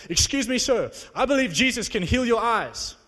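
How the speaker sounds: eager retold call. Retold bold approach to stranger.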